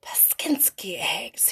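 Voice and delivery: soft unvoiced whisper, slow. A narrator's reading. Old man whispers over the decorated eggs. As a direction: breathe it in quiet wonder.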